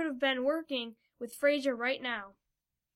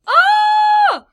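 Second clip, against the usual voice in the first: very high, loud held scream; one long terrified shriek, acted; 18 dB louder than usual. A narrator's reading. The group cornered by Fido, high scream. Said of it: "the group screamed".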